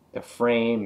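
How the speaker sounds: ordinary talk, nothing marked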